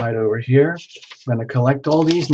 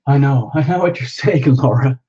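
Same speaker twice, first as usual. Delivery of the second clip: ordinary talk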